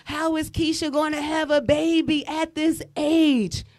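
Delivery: slow, drawn-out scandalized judging; judgmental gasp. Retold or imitated. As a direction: act shocked and judgy. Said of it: "We like".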